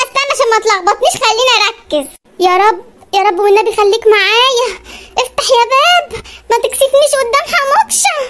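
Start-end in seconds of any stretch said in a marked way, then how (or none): 5.2-8.2 mock tearful plea. Wife begs stuck lift door to open, comic teary plea. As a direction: beg the door not to shame her.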